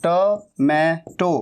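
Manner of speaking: टमैटो slowly as "tuh, may, to"; syllable by syllable. Shown as a demonstration. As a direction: break टमैटो into three spaced syllables. Syllable demonstration by teacher.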